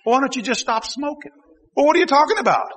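ordinary talk

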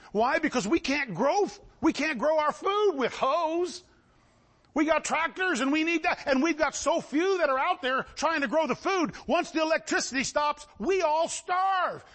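high, urgent pulpit warning; fervent preaching. Real, not acted. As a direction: build the alarm, stretching the final words. Preaching at full force.

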